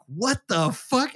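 retold bully, stretching each word in disbelief; drawn-out incredulous challenge, rising at the end; indignant disbelief. Bully challenged by timid coworker.

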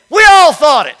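loud, high retorting shout; mock shout. Comedian answering his jailed friend, in a mock shout. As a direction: bellow it back at him, half-joking.

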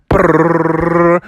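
lips buzzing like wheels; rolling brrr sound. Pretend. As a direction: imitate a wagon rumble. Wagon noise.

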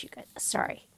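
hushed confiding whisper; secretive hushed aside. Real, not acted. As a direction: lean in and whisper to the listeners.

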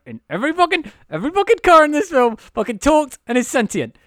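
high, loud indignant rant; excited rant. Real, not acted. Host ranting to cohost about talking cars, high and loud. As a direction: get worked up about the cars.

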